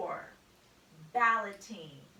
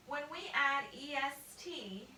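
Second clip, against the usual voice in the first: ordinary talk, nothing marked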